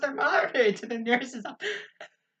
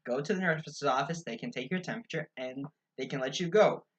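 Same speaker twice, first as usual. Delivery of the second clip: lower, softer mock voices; quiet, lowered mock voices of kid and mom; low mock dialogue; 9 semitones lower, 9 dB quieter than usual. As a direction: play both sides low and quiet.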